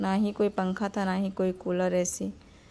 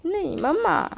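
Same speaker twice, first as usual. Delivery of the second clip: put-on high, swooping child's protest; insistent daughter; 8 semitones higher than usual. A narrator's reading. Daughter refuses to go out without mother, high insistent whine.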